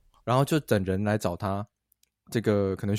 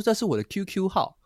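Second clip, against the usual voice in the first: put-on girl's voice; 7 semitones higher than usual. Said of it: "她说".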